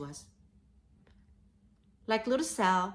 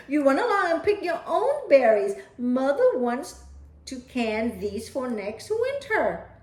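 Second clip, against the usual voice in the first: louder, quicker motherly shooing; busy mother shooing; 6 semitones higher, 8 dB louder than usual. Acted. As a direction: send the child off while working. Mother shoos child away.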